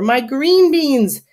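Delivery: slow, high wail; hollered lament. A narrator's reading. Neighbor mourns crushed garden, hollered lament. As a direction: stretch it out in anguish. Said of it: "hollers the next door neighbor".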